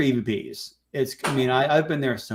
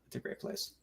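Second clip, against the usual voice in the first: breathy whispered aside; 20 dB quieter than usual. Real, not acted. Guest tells the host in a whispered aside, hushed.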